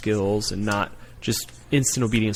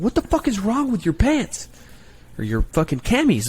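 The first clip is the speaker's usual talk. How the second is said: drill yell; 10 semitones higher than usual. Retold or imitated. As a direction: yell it at the recruit in disbelief. Recruiter yelling at recruit.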